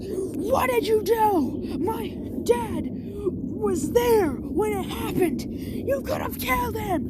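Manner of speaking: slow, high mock-accusing voice; angry bully. Bakugo accusing Deku over his dad, high slow mock anger.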